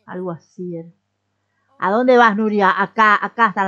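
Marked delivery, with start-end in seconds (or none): 1.8-3.7 loud call to a departing customer; loud questioning call; calling after someone. Real, not acted. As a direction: shout to stop her leaving. Calling after a leaving character.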